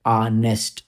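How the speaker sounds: ऑनेस्ट said with a silent h, starting on aa; ऑनेस्ट without the h; pronounce honest. Shown as a demonstration. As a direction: say ऑनेस्ट as aa-nest. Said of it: "एच तो है मगर आ की sound होती है".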